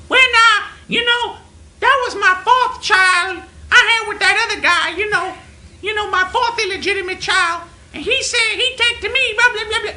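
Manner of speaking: high, loud, mocking caricature; imagined manager on phone. Caller imagining the manager's personal call, loud high mockery. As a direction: blare the gossip in a put-on voice. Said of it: "imagine what a personal call was about".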